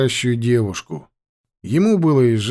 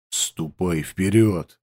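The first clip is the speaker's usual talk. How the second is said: growl; 6 semitones lower, 4 dB quieter, slower than usual. Acted. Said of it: "рыкнул тот".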